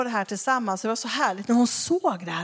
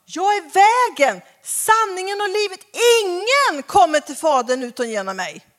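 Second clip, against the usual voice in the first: proclaimed loud; 7 semitones higher, 6 dB louder than usual. Shown as a demonstration. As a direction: declare each word grandly. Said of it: "Förstår ni vad Jesus är radikal".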